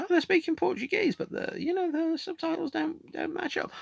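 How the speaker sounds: very high, put-on squeaky voice; puzzled Portuguese viewer. Imagined Portuguese viewer noticing the subtitles, high comic voice. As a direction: voice a baffled foreign viewer in falsetto. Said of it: "if you're Portuguese, you're watching it and you go".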